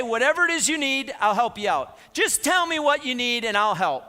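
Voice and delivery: loud, high, over-eager promise; high, emphatic show of generosity; showy offer of help. Acted. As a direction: play up a big offer of help to look good. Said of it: "it makes me look good to say".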